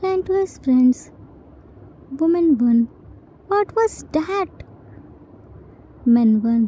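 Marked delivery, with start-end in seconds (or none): 3.5-4.6 shocked. A narrator's reading. Guest shocked by horrible voice.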